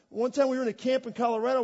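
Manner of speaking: normal talking voice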